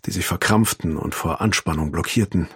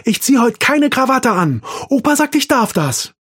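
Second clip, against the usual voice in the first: high, loud triumphant yell; shouting; 5 dB louder than usual. A narrator's reading. Arthur ruft der Mutter nach unten, laut triumphierend. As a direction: shout it down the stairs. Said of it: "brüllte Arthur".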